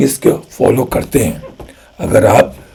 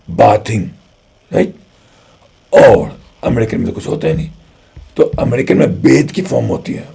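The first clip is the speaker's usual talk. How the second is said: usual speaking voice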